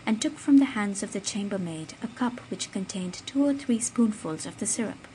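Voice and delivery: usual speaking voice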